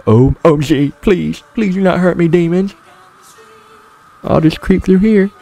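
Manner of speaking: high, scared pleading to demons. Pretend. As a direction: beg in a high frightened voice.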